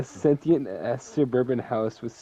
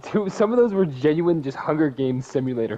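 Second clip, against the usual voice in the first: much higher and louder burst; excited; 6 semitones higher, 7 dB louder than usual. Real, not acted. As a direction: exclaim it. Excited laughing comment.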